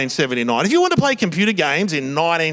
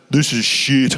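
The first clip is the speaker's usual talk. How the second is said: put-on lower, flat teenage grumble; sulky kid dismissing the car ride; sullen teen mutter; 5 semitones lower, slower than usual. Son in the car, sulky low grumble. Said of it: "He just goes".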